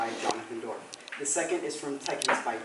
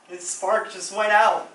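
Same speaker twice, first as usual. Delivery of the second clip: halting mock-tragic account with long pauses; hushed tragic storyteller; 6 semitones higher, 8 dB louder than usual. Actor recounting William's breakdown, grave melodrama. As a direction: let each phrase land like a death knell. Tells rival's victim's tragic downfall.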